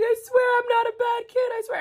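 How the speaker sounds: very high, loud imitation of a wailing little girl; mock hysterical kid's wail; hysterical child crying. Little girl pleads with store worker after dropping blush, hysterical mock crying. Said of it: "hysterically started crying".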